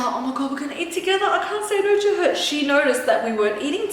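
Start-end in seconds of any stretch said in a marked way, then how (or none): 0.0-2.3 higher and rushing at the end, mock panic; mock excited panic. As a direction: build into giddy, fast fake alarm. Retelling her own nervous excitement.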